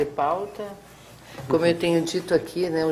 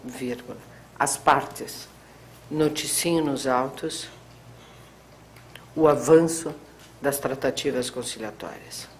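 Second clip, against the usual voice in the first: dictating; 4 dB quieter than usual. Real, not acted. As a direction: spell out the ruling for the typist. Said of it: "parágrafo".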